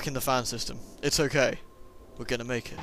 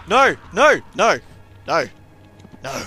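repeated no; 7 dB louder, slower than usual. Real, not acted. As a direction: yell no over and over. Repeated protest.

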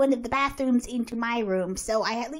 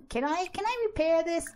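usual speaking voice

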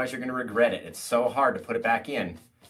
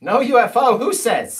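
indignant surprise; 10 semitones higher, 10 dB louder than usual. Real, not acted. Indignant protest at wife.

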